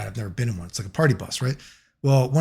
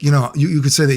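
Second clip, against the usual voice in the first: ordinary talk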